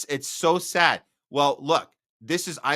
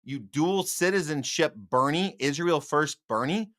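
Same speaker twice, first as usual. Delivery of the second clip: normal talking voice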